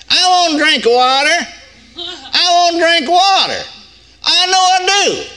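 very high, drawn-out mock child whine; little kid imitation. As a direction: imitate an insistent small child demanding something. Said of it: "acting like a little bitty kid".

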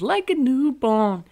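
high slow mocking. Mocking the lyric.

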